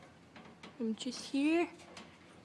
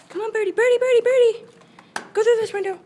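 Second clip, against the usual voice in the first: loud, higher coaxing call; 7 semitones higher, 12 dB louder, faster than usual. Real, not acted. Loudly coaxing the bird out through the window.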